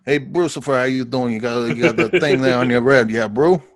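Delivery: lightly imitated Afrikaner-like accent; Sue. Co-host greeting Brucifer as Sue, light Afrikaner accent impression. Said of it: "a little bit of accent".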